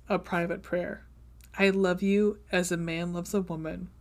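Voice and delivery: soft, low romance reading; reading aloud softly. A narrator's reading. Reading a favorite passage to a friend, softly. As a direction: read the love letter gently and quietly.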